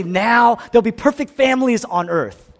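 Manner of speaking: high, loud announcement; mock proclamation. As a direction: proclaim it like grand news. Mimics a proclamation nobody makes.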